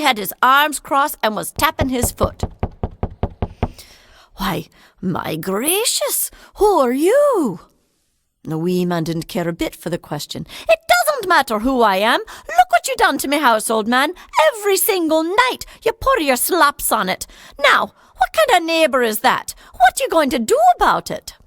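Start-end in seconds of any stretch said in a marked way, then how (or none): 4.4-6.3 slow hushed gasp of wonder; awed whisper; startled whisper. Acted. Old man startled by wee man. 6.6-7.6 higher, louder, slow astonished ask; astonished old man; startled question. Acted. 10.7-21.3 higher, louder little man scolding; furious fairy voice; angry wee man. Acted.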